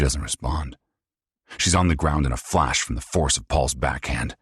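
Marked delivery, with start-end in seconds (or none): none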